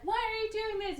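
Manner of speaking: high-pitched imitation of an upset woman; mock distressed plea. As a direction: plead in a hammed-up high voice. Doctor confronts villain, retold.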